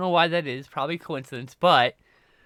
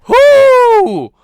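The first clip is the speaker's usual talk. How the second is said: high startled yelp; startled whoo; 20 dB louder than usual. Real, not acted. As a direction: jump and shout whoo. Startled by pet.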